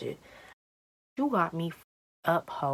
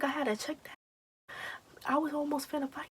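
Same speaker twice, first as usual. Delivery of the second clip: tag worked-up friend; 10 semitones higher, 6 dB quieter, faster than usual. Retold or imitated. Imitating the agitated friend.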